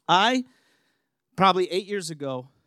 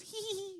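giggle; 15 dB quieter than usual. Retold or imitated. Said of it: "she goes".

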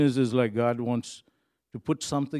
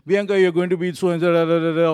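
fast, louder, higher prophetic declaration; prophesying over someone; 5 semitones higher, 7 dB louder, faster than usual. Retold or imitated. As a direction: rattle off a prophecy with rising fervour. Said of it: "I can prophesy to Bianca".

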